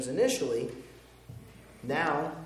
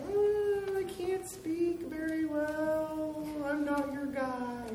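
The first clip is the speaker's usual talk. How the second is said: much higher, slow whining voice; whiny Moses; 3 dB quieter, slower than usual. Retold or imitated. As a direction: whine it out high and drawn, playing Moses. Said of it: "He whined at God".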